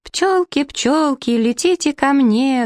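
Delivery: high, drawn-out singsong call; calling from above. A narrator's reading. Prince up the birch calls the bees, high singsong. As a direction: coax the bees from a treetop in a high voice. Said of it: "донеслось откуда-то сверху".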